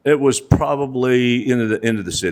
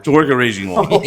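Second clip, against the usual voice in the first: laughing; 4 semitones higher, 4 dB louder, faster than usual. Real, not acted. Laughing while repeating daughter's job.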